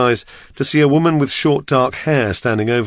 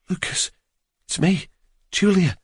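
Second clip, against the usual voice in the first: gentle; 7 semitones higher, 4 dB quieter than usual. Acted. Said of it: "A warm hand touched my face".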